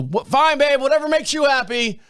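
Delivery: a husband waving off his wife's question, mock dismissive. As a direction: shrug it off without turning round. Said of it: "he said".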